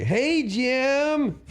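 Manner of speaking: high-pitched, drawn-out mock greeting; Andy Dick. Andy phoning Jim a week after the podcast, high mocking impression. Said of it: "Not, not a bad impression".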